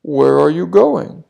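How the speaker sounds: put-on the wolf sweet-talking the lone girl; big bad wolf. Wolf meets girl in forest. (the wolf luring the girl, sly)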